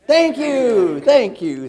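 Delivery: big, booming thank-you; loud thanks. Real, not acted. Coach leads the room in thanking their helpers, loud calling out. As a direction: call thanks out to the whole room.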